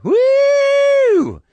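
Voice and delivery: high, loud party woo; drunk woo. Retold or imitated. Drunk fan in the crowd, loud high woo. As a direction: let out a long shrill woo. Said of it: "We've got a fucking wooer".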